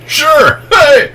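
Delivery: high eager yelp; excited. Retold or imitated. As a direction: blurt it out, pumped. Quoting his eager reply.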